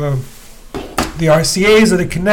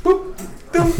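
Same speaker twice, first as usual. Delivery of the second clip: imitated button boops; 12 semitones higher, 6 dB quieter than usual. Mimicking turntable button sounds.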